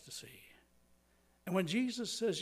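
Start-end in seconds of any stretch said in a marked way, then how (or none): 0.0-0.4 whisper. Acted. Grave point about blindness.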